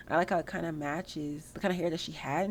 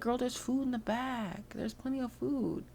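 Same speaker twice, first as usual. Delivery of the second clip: put-on higher and slow, drawn-out girl talk; slow sassy sim voice; 6 semitones higher, slower than usual. Sim telling the hungry guests there's food in back, slow and higher. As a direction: point them to the food like a know-it-all friend.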